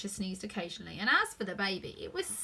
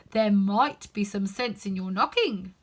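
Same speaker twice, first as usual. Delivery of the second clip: footman voice; 7 dB louder, slower than usual. A narrator's reading. Footman talks past Alice.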